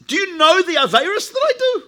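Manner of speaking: high-pitched, insistent mock protest; indignant boast. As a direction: act out a sinner protesting in disbelief. Sinner boasts of his sins.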